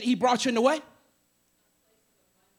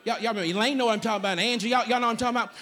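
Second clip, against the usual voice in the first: usual speaking voice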